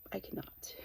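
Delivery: soft unvoiced confession; whispered aside. Real, not acted. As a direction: drop to a whisper for the admission.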